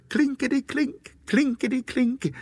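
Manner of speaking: high, crisp rattling coins; high-pitched clinking singsong; imitated coin rattle. A narrator's reading. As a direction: chime it high like jingling coins. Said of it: "when he heard".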